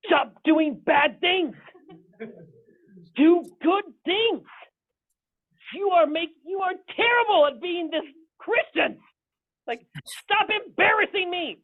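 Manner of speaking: high, loud, slow scolding shout; high furious rant at the church; exasperated pastor yelling. Retold or imitated. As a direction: blow up at the congregation like a fed-up preacher. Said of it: "he's just like".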